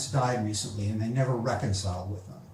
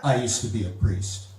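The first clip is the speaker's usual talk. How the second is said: low, subdued confession; somber ex-priest; 6 semitones lower than usual. Acted. Expert confessing to the family, low and somber. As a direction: confess it low, lightly in character. Acts the washed-up movie expert.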